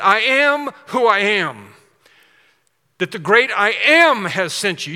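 preacher voicing God with force; emphatic proclamation. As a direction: proclaim it grandly as God, leaning on key words. God proclaiming his name.

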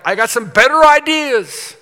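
loud, high and eager; bursting with a new plan, over-keen; eager boast. Retold or imitated. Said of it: "my response would be".

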